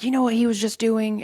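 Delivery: high, loud, frightened urgency; alarmed. Retold or imitated. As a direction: wake her up in alarm. Waking mom in alarm.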